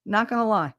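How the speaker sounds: usual speaking voice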